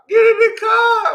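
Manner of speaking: very high mock-female shout; imitated yelling. Girl ordering her into the car, high shouted imitation. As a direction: squeal the order at full pitch.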